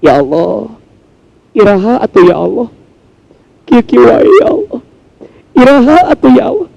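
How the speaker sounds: a mother crying while she waits for help; lightly acted Sundanese lament; mild tearful prayer in Sundanese.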